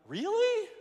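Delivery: shot up high in disbelief; incredulous. Retold or imitated. Man on a bungee bar hearing the instructor, high incredulous squeak. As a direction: squeak it out as if she can't be serious. Told to let go over drop.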